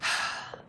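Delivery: long tired sigh; weary sigh. Real, not acted. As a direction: let out an exasperated sigh. King weary of repeated argument.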